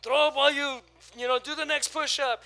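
hammed-up tough trainer. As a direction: order him on like a drill coach. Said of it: "one of those guys".

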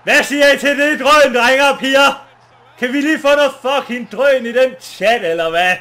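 loud, rousing calls to the crowd; hyped-up shouting. Real, not acted. Streamer to the boys and girls in chat, hyped shouting. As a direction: fire up the chat at full volume.

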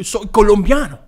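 lightly put-on Spanish accent. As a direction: say it in Colombian Spanish. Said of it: "I can say it 'cause I'm".

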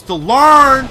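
loud, stretched final word; boastful yell. Pretend. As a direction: blast out the last word triumphantly. Mock-boast ending in a yell.

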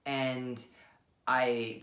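ordinary talk, nothing marked

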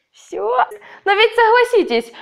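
ordinary talk

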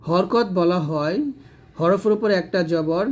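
normal talking voice

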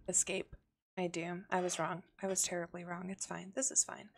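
ordinary delivery

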